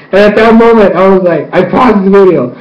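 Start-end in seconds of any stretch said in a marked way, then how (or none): none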